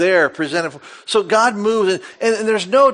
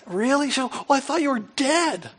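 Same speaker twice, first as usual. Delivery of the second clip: higher, softer startled wife voice; 8 semitones higher, 6 dB quieter than usual. Retold or imitated. Wife waking her snoring-free husband, startled. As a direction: play the wife jolted awake. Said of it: "my wife will wake me up".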